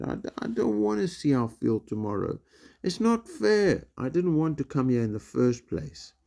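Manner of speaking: put-on a boy near tears begging his dad; upset protest. Homesick boy pleads with dad on phone, high whine.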